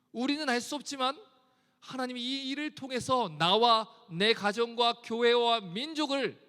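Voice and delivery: ordinary talk, nothing marked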